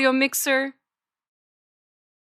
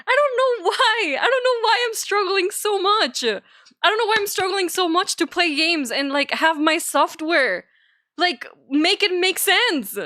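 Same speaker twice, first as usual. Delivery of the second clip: exasperated whine; 10 semitones higher than usual. Real, not acted. Venting about weak PC.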